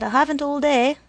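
high, loud impatient prod. A narrator's reading. Woman with no time to wait, impatient. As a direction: press him to get moving. Woman hurrying the boy along.

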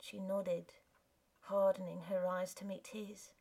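usual speaking voice

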